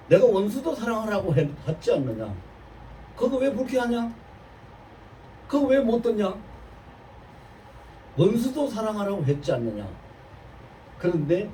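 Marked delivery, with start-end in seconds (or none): 0.1-9.9 a hint of a stern divine rebuke, higher; voice of the Lord. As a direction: gently rebuke like God speaking. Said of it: "주님 한 말씀 딱 하시더라고요". (하나님의 꾸짖는 음성을 살짝 흉내 낸 말투)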